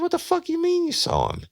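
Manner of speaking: high, stunned disbelief; shocked. Retold or imitated. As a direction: react in total disbelief. Detective's shocked reply.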